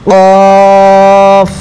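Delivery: কফ drawn out long and heavy, held for three alif counts; কফ টেনে মোটা করে; long heavy khaf. Shown as a demonstration. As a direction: stretch কফ with a thick, heavy sound. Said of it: "তিন আলিফ পরিমাণ টান দিয়ে পড়তে হবে".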